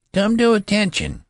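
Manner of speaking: put-on high, loud cracking boy's command; a treble voice straining to command; failed thunder. A narrator's reading. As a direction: try to thunder but the voice breaks high. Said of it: "he tried to thunder".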